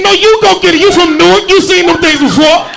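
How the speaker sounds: 2 high, loud panicky pleading; 3 push the job back on her in a panic; 1 mock panicked refusal. 4 husband refusing to chase the mouse, high panicky voice. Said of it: "I said".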